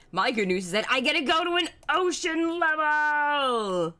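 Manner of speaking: high, rising shout of delight; excited shout. Real, not acted. Streamer announcing an ocean level, high excited shouting. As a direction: build to a thrilled, stretched yell.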